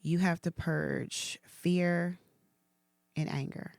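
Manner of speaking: very slow, hushed, grave words; slow solemn God. Retold or imitated. Recalling God's instruction, slow and hushed. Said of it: "And he was like".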